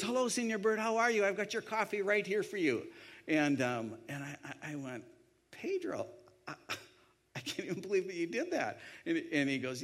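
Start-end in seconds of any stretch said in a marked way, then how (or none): 0.0-2.8 high-pitched, beaming waiter hello; big-smile welcome in a put-on waiter voice; delighted greeting. 5.5-8.8 high-pitched, amazed reaction; incredulous, halting gratitude, replayed; touched disbelief. Acts own amazement at waiter's kindness.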